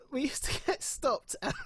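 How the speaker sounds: laughing through the words, then a high laugh; real laughter breaking in. Real, not acted. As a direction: giggle while you say it. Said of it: "sorry, it's not even funny".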